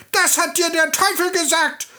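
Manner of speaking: furious little goblin, pitched very high; schreiendes Männlein. A narrator's reading. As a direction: squeal it in a helpless rage. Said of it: "schrie das Männlein". (wütend kreischendes Männchen)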